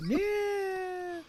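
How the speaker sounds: long, high, thin drawn-out yeah; mock wail. Co-host joking that his only feeling is sadness, mock wail. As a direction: stretch it into a high whine.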